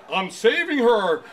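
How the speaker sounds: slow, loud, high cartoon voice; cartoon character reply. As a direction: answer like a cartoon hero. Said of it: "and I was like".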